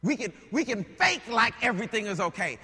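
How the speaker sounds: loud preaching, driving each phrase up high; full-force preaching. Acted. Preacher pressing the congregation about ignoring problems, loud preaching.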